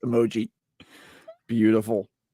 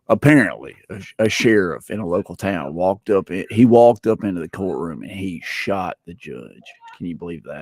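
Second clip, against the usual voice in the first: hammed-up country accent, lower than usual; 4 semitones lower than usual. Shown as a demonstration. Retelling local courthouse news for the group, country accent. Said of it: "let me do my country accent".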